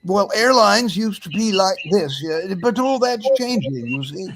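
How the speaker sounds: hammed-up English airline ad voice; Peter Sellers impression. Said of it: "I did that impersonation".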